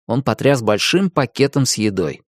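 ordinary delivery, nothing marked

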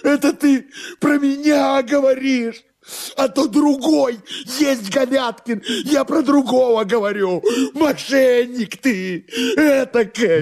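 hysterical, very high drawn-out screaming; out-of-patience shouting. Acted. Master rages at servant over mistaken identity, hysterical high shouting. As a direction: shriek in tearful outrage, voice far up. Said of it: "вышедший из терпения герой наш".

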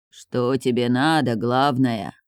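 low, slow voice of a spirit from the abyss; hoarse otherworldly voice. Acted. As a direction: drag it out, rasping, from beyond. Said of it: "хриплый потусторонний голос".

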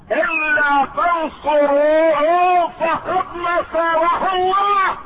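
high, slow, ringing proclamation of scripture; soaring pulpit declamation; full-force preaching. Acted. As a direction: build to a high, drawn-out cry on the verse. Proclaims God's actual words.

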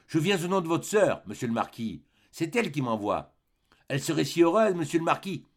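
ordinary delivery, nothing marked

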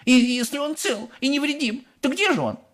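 very high-pitched cross retort; snapping back. A narrator's reading. Mother demanding her son from Wonka, snapping. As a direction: snap it as an impatient mother. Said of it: "огрызнулась миссис Глуп".

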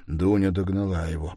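ordinary delivery, nothing marked

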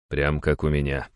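ordinary delivery

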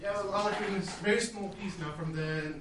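distant, off-mic voice; speaking from across the room; calling from far away. Real, not acted. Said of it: "we can't hear you speaking too well from there".

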